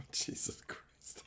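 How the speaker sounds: whispered under a laugh; slow, breathy whisper to himself after a laugh; breathless whisper. Real, not acted. Streamer whispering to himself after his own joke, whispered laugh.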